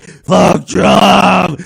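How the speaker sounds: wild cyclist's shout; imitated shout. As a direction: yell it like a raving drunk. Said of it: "he just started yelling".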